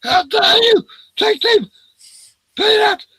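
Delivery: very high, loud indignant shriek; hammed-up furious Karen; shrill mock outrage. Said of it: "She's going".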